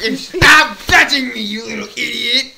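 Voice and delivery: loud scolding that eases off; cartoon annoyed shouting. Toy character telling another off, hammed-up annoyed shout. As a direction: bark it, then settle.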